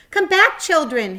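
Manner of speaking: high, loud desperate call; cried out. A narrator's reading. Wilbur crying out to the departing babies, loud. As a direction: call after them as they float away. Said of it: "he cried".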